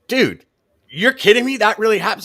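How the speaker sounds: high, loud disbelief; astonished. Retold or imitated. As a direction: shout it out in shock. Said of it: "And I'm like".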